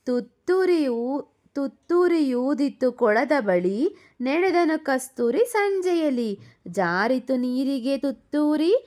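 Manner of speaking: put-on slow, sing-song children's rhyme; poem recitation. Mother reciting a rhyme for her daughter, slow sing-song.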